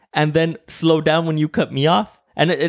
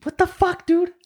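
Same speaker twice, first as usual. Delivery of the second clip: much higher, incredulous what the fuck; incredulous outburst. Retold or imitated. Quoting himself at a driver who speeds up, high incredulous outburst. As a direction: shriek it in disbelief. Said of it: "And it's like".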